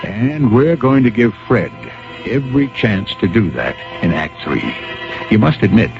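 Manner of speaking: ordinary talk, nothing marked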